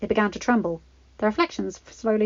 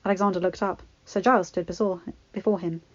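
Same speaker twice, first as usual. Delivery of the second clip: ordinary delivery, nothing marked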